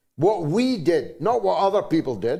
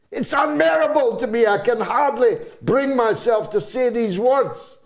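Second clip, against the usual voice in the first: real upset breaking through, pushed higher; emotional; 7 semitones higher, 3 dB louder than usual. Real, not acted. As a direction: voice rising, faster, overcome with feeling. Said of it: "I'm getting emotional".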